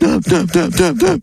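squealing pig counting in oinks. Acted. A farmer's pig oinking its answer. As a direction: grunt the numbers like a pig.

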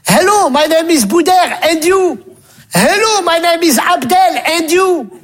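high, recited beginner English; schoolboy English. Acted. Two pupils graded on an English conversation, high schoolboy recital. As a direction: recite the textbook greeting high-pitched.

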